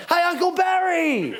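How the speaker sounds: high, drawn-out mock call; acted yell. Driving past people to confuse them, high drawn-out yell. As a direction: call it from a passing car. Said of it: "I yell other stuff".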